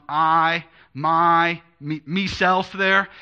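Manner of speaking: I, my, me hit loud and slowed; stressed singular pronouns. Shown as a demonstration. Preacher pointing out Jesus' pronouns, pronouns stressed. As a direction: punch each pronoun I, my, me. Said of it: "subtle shift".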